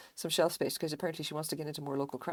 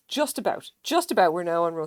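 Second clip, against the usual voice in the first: excited; 10 dB louder than usual. Real, not acted. Excited catch-up.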